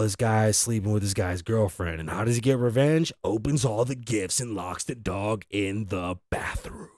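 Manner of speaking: low, soft mock movie-trailer narration; movie plot voice. Telling a friend [name]'s revenge as a movie plot, in a low mock trailer voice. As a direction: pitch it like a dramatic film plot, lightly. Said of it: "it sounds like a great plot to a movie".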